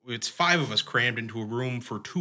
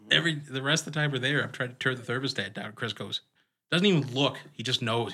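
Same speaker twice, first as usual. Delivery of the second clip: usual speaking voice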